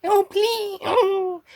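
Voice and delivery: very high creature voice; Meep character voice. Acted. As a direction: plead in a tiny high voice. Voicing the Meep between takes.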